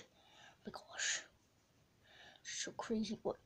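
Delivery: hushed whisper, slow; awed secret whisper. Acted. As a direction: breathe it out so no one hears. Sneaking food in a stranger's house.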